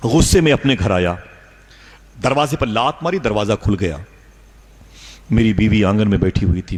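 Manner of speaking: ordinary talk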